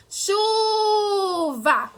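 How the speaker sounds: "chuva" sung out high, first syllable held very long; drawn-out calling. Shown as a demonstration. Teacher showing pupils the strong syllable, drawn-out call. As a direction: stretch the chu of "chuva" like a singsong call. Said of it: "A sílaba que você demora mais cantando".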